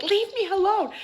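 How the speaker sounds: high, loud imitation of sister; hammed-up tantrum yell; mock scream. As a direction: wail it as a mock outburst.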